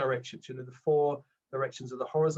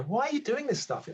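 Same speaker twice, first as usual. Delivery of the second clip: much higher, mocking disbelief; scolding inner ego; 9 semitones higher than usual. His ego questioning his new interests, higher mock disbelief. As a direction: sneer at yourself as the doubting ego. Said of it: "I remember my ego at the beginning go".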